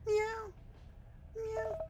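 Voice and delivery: high mock cat meow. Streamer greeting the game cat, mock meow. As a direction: meow like a tiny kitten.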